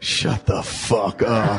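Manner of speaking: slow, drawn-out retold shout; mock furious shout. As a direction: stretch out every word in mock fury. Retelling his dark snapped reply.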